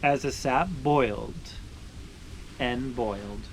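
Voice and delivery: slowing, softening read-aloud ending; story narration. Acted. Finishing the sugaring story for viewers, gentle storytelling close.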